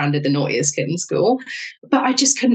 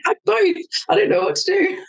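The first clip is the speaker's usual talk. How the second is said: giddy high helplessness; 13 semitones higher than usual. Retold or imitated. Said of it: "I was like".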